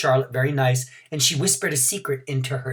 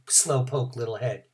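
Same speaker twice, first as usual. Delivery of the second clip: ordinary delivery, nothing marked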